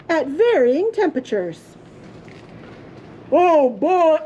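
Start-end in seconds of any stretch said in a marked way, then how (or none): 3.3-4.2 loud booming papa. A narrator's reading. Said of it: "in his loud, booming voice".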